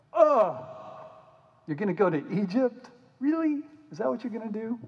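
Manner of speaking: high, incredulous mock scolding; hammed-up exasperated prophet; exasperated disbelief. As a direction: roll your eyes and ask it. Isaiah exasperated with the people.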